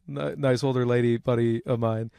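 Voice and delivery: normal talking voice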